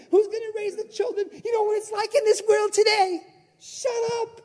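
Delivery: high, quick fretting; lightly voiced anxious believer; worried questioner. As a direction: rattle off the worry.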